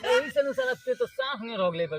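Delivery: ordinary talk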